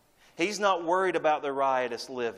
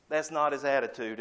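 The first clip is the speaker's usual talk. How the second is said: ordinary talk, nothing marked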